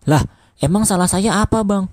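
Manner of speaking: high, slightly intimidated protest; a touch of cowed defensiveness; getting scared. A narrator's reading. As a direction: protest as nerves creep in. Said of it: "sahut Mamat mulai jiper".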